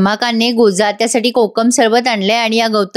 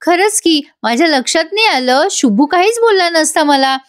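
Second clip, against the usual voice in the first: mock realization; 6 semitones higher than usual. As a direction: stretch it out like a sudden fake realization. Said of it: "आता गौतमी म्हणते".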